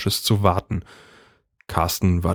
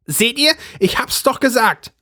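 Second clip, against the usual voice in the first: high, triumphant I-told-you-so; pitched-up gloating housewife; triumphant wife. A narrator's reading. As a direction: crow it to the room. Brigitte triumphant.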